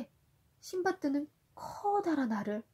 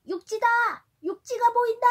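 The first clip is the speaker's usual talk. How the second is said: high, loud lookout cry; excited shout; 12 semitones higher, 8 dB louder than usual. Acted. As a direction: call out land from the mast. Lookout sailor calls out land. (신나게 외치는 소리)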